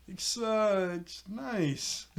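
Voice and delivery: imitated awkward backpedal; awkward compliment. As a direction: act out a halting, unconvincing compliment. Retold awkward compliment after shock.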